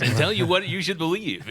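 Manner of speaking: high, loud announcer voice; hammed-up announcer pitch. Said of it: "get into my announcer voice".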